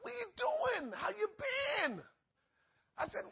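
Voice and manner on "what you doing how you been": excited chat. Retold or imitated. Chatting up the ex-governor.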